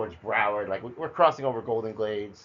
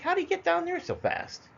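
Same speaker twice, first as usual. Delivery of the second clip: much higher, amazed question; bewildered at how far he got; astonished. Retold or imitated. Brother suddenly three houses down.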